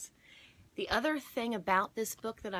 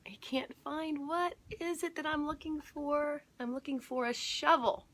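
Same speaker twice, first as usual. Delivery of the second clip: high, fumbling, then louder at shovel; searching old man; 10 semitones higher, 3 dB louder than usual. Acted. Storyteller voicing the forgetful neighbour, high and fumbling. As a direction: play a forgetful neighbour groping for the word.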